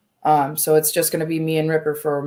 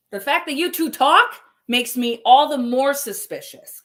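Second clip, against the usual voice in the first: louder and higher, furious accusation; angry outburst; 8 semitones higher, 5 dB louder than usual. Real, not acted. Streamer confronting the two who talk, furious and loud. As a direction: let the anger spike on every word.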